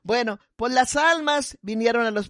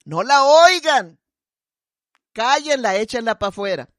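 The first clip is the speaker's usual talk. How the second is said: loud heckle; 6 dB louder than usual. Retold or imitated. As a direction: shout them down, dragging the last words. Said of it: "con voz bien fuerte decía".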